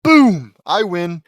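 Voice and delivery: booming, high victory shout; gloating triumph. Acted. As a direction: explode with smug triumph. Lynn gloats over winning.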